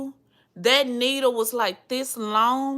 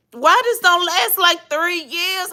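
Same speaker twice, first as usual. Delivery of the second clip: mock outrage; 8 semitones higher, 5 dB louder than usual. Indignant complaint to the doctor.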